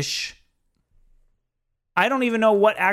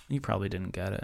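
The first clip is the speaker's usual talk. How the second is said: low, much softer mock put-down; imitating condescending girlfriend; 9 semitones lower, 13 dB quieter, faster than usual. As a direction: murmur it down at him like she's above it. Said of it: "she was like".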